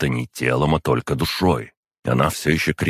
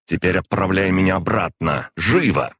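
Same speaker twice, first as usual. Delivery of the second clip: higher-pitched, urgent order; brisk command; 5 semitones higher, 3 dB louder than usual. A narrator's reading. Demon orders her summoner to send her back, brisk command. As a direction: pull yourself together and order him. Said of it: "И тут же собралась".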